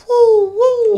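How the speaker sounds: high, loud whooping cry; excited whoop. Acted. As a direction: whoop as you jump in. Leaps into the sea.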